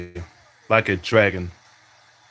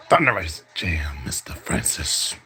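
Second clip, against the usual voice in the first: whispered mock announcer call; 5 semitones lower, 3 dB quieter than usual. Gamer calling his own dunk, whispered mock commentary. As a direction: hush it like a secret highlight call.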